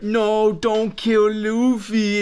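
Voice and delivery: hammed-up girl begging for his life; pleading cry. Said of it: "Nami's like".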